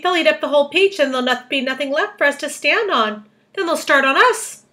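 high, loud, hammed-up despair; panicked cry. A narrator's reading. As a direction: wail it out in storybook panic. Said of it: "cried Miss Spider, wringing her feet".

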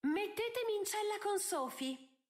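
high, melodramatic plea; theatrical exclamation. A narrator's reading. Daughter demanding to join Sophie in jail, theatrically. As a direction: declaim it like a tragic heroine. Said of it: "esclamò Posy in tono teatrale".

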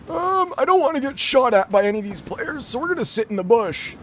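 put-on high, strained old man voice. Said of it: "I'll still play like Old Man [name]".

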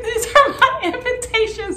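very high mock-tearful whine; hammed-up weepy protest, laughing through it; retold crying. Woman upset by her own invitations, mock crying. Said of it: "I just kept crying".

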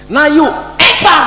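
high, drawn-out robber's order; armed robber barking an order at a hostage; slow shouted command. Retold or imitated. Robber orders him to get in.

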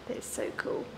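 soft unvoiced whisper. Real, not acted. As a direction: hush it to a whisper. Whispers the same phrase again.

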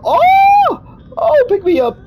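high startled yelp; panicked shout. Real, not acted. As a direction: cry out in sudden fright. Grabbed by monster, panicked.

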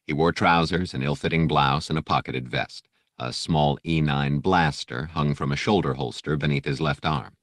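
ordinary delivery

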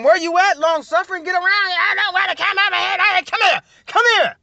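high, urgent playful summons; calling someone over. Pretend. Preacher calling long suffering to come around, high mock summons. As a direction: holler for her to get over here.

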